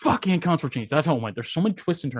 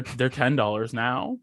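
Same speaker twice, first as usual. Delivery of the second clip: ordinary talk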